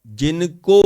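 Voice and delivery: जिनको loud and drawn out; stress on जिनको. Real, not acted. Preacher singles out a word for listeners, stress on जिनको. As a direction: land hard on जिनको, stretching it.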